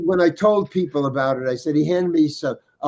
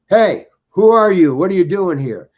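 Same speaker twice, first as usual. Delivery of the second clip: retold challenge; 5 semitones higher, 9 dB louder than usual. Stranger confronts him on set.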